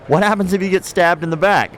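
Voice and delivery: high-pitched sarcastic question. Retold or imitated. Audience member heckles the seminar instructor, sarcastic. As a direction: imitate a heckler asking with mock curiosity. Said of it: "being sarcastic".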